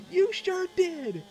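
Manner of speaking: high drawn-out yell; reenacted shrieky, stretched-out reply; excited confirmation. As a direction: confirm it in a loud falsetto.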